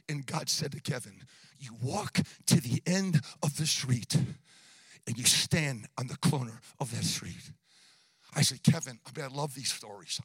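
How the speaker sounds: low, subdued retelling; hushed sermon storytelling. Real, not acted.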